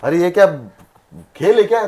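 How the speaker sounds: very high, loud outraged cry. Acted. Father exploding at his son's decision, high loud outrage. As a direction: explode at the nonsense.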